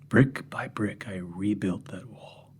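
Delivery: slow whispered confession; hushed chilling confession; grim whisper. Acted. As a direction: breathe it out, savouring it.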